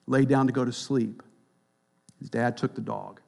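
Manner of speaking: ordinary talk, nothing marked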